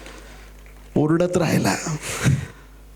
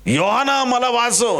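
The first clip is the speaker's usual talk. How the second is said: high drawn-out plea, falling away; acted call to a friend for rescue; cry for help; 10 semitones higher, slower than usual. Drowning Peter calls to John.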